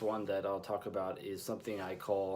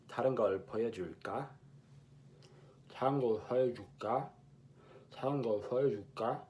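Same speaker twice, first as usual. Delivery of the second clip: tongue held down; much faster than usual. Shown as a demonstration. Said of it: "if you depress your tongue with your finger".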